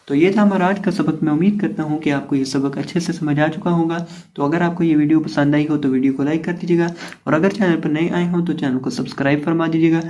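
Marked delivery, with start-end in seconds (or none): none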